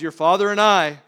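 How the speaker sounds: scolding mom. Retold or imitated. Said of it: "mom comes".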